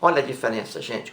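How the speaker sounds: ordinary talk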